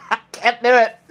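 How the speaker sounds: words broken up by a real laugh. Real, not acted. Host listening to the mashup song, laughing helplessly. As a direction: laugh through it, giving up.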